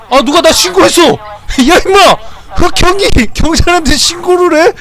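high, loud indignant shouting at the NPC; furious streamer yelling at the screen; angry yelling. Real, not acted. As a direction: yell in outrage at being snitched on. Reported to police in game, furious.

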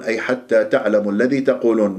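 normal talking voice

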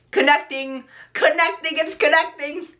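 high, rising excitement on each repeat; excited anticipation. Real, not acted. Vlogger watching the earbuds connect, high and excited.

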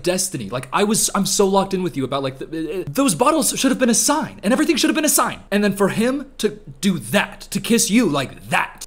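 ordinary talk, nothing marked

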